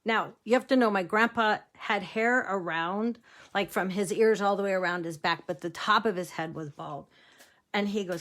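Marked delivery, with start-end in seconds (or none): none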